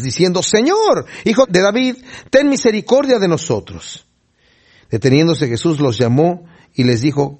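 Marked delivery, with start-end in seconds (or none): 0.4-4.1 insistent, higher-pitched plea read aloud; clamando más. A narrator's reading. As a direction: call out for mercy in a high voice. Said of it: "pero ellos clamaban más". (clamando con insistencia, en tono agudo)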